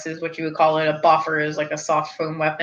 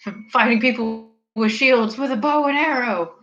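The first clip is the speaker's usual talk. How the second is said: mock exasperated; 6 semitones higher than usual. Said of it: "it'd be like".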